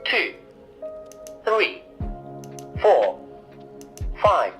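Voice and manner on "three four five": ordinary delivery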